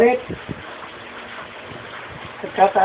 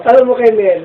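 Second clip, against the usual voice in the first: louder, higher call to get attention; 4 semitones higher, 9 dB louder than usual. Real, not acted. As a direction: call it out loudly to Nay. Calls to mother, then repeats normally.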